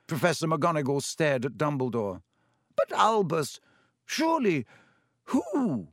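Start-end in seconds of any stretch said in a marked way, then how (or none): 2.8-5.9 high, broken anxious pleading; halting, alarmed questions; alarmed disbelief. A narrator's reading. McGonagall pleading with Dumbledore, alarmed.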